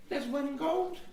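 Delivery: put-on high-pitched little boy voice; small high voice naming a price. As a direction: pipe it up like a small kid. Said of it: "he sort of is like".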